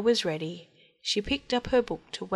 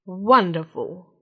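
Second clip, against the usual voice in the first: delighted frog; 6 dB louder, slower than usual. Acted.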